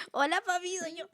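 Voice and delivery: high, sweet greeting with a catch of tears; sweet little girl. Pretend. To God as her papa, high sweet childlike greeting. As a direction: greet him like a child coming home.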